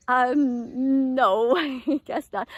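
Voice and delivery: laughing. Retold or imitated. Clergy laughing at cow joke.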